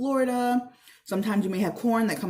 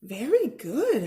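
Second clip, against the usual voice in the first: teacher praise; 5 semitones higher, slower than usual. A narrator's reading. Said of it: "Mister Mittal said".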